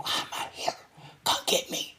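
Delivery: hushed whispered begging; whispered plea. Retold or imitated. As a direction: whisper it like a scared kid wanting out. Child begging to be fetched.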